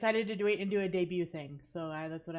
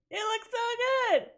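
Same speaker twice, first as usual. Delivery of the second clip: much higher, louder, slow; high excited exclaim; 8 dB louder than usual. Real, not acted. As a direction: squeal it with delight.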